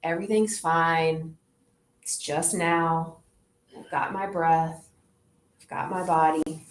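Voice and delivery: low soothing inner voice, grounded; calm self-talk. Shown as a demonstration. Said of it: "get back to the earth and say".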